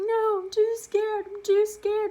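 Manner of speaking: loud, high, slow wailing plea; panicked little girl. Retold or imitated. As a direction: wail the refusal. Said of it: "I'm like".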